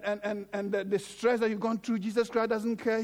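usual speaking voice

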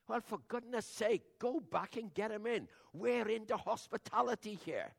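hammed-up outraged dad sending them back; mock indignant father calling out; exclaiming father. Father scolding daughters in story.

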